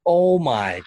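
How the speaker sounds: stunned oh my God; real amazement. Real, not acted. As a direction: blown away by the story. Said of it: "her own reaction".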